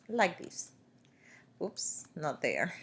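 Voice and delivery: ordinary talk, nothing marked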